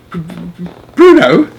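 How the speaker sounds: faint stammer bursting into a high cry; father stammering in disbelief. Acted. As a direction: stumble on the name in shock. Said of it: "stammered Mister Jenkins".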